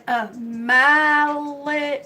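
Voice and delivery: syllables stretched right out; very slow. Retold or imitated. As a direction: draw it out for someone not understanding. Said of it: "but slowly".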